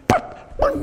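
high, squeaky mock yelp of the woman; imitated yelp. Preacher imitates tased woman's squeal, high and comic. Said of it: "she turned around and went".

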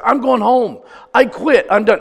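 loud, high retold outburst; exasperated, emphatic declaration of defeat; fed-up quitting. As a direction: act out a man throwing in the towel. Retold giving up in despair.

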